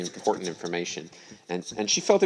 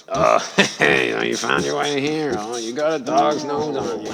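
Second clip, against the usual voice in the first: higher, louder comic dog greeting; talking dog; 5 semitones higher, 6 dB louder than usual. Acted. Dog greets the woman who found him, impressed.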